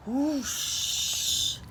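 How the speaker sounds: ordinary talk, nothing marked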